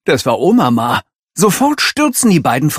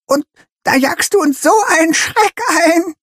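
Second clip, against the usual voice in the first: put-on quivering old grandma scolding; shaky voice. A narrator's reading. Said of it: "mit bebender Stimme".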